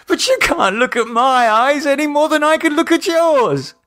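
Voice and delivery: high, loud, frantic yelling; hysterical shouting. A narrator's reading. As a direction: shout it in blind panic. David shouts back.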